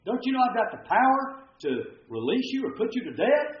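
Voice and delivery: incredulous, high-pitched challenge; high, exasperated questioning; flabbergasted. Retold or imitated. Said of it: "flabbergasted Pilate".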